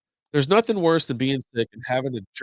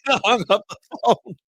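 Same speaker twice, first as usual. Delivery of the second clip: laughing; 10 semitones higher than usual. Real, not acted. Laughing as he tells it.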